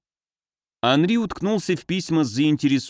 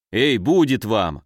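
plain reading voice

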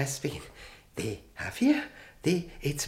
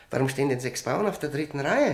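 normal talking voice

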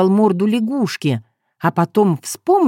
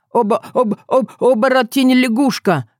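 high, stammering shock; stunned gasp; 6 semitones higher than usual. A narrator's reading. Young man facing a frog turned human, stammering in shock. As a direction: trip over the first syllable in alarm, pitched up. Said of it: "выдохнул Илья".

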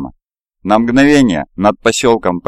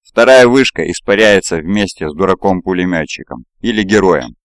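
ordinary delivery

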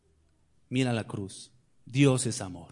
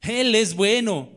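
Él es bueno rung out higher, louder and slow; loud, slow proclamation; 6 semitones higher, 6 dB louder, slower than usual. Shown as a demonstration. Preacher answering his own ¿Sí? with a loud, slow proclamation. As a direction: lean into "Él es bueno" slowly and loudly. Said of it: "¿Sí?".

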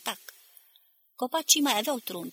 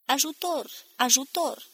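higher, restrained cry for help; desperate cry; 6 semitones higher, slower than usual. A narrator's reading. Despairing chorus across the whole plain, crying for help. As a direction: cry out hopelessly, pitch lifted but not shouted. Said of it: "ca un cor desnădăjduit".

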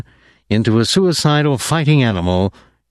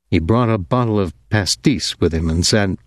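plain reading voice

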